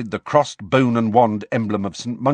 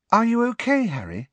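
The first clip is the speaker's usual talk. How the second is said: put-on high-pitched girl, gently asking; Ginny, quietly concerned; 13 semitones higher than usual. A narrator's reading. Ginny asking Harry if he's all right, quietly concerned. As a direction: check on a friend in a high girl's voice. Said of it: "Ginny asked quietly".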